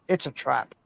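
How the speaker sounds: low, soft robotic alarm; alarm clock voice. Retold or imitated. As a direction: alarm clock droning the warning. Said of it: "your alarm should say".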